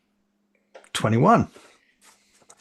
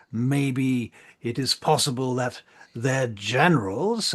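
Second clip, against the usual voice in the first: slow, higher-pitched orator addressing the assembly; Marshal Ainslaw; 5 semitones higher than usual. Acted. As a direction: urge caution in measured, emphatic public oratory. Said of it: "he says".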